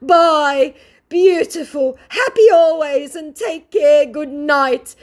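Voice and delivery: high, loud gushing goodbye; mock scammer voice. Vlogger mocking the scammer's gushing farewell. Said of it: "he says".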